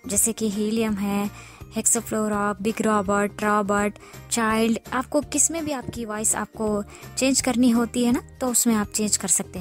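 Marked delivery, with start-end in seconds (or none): none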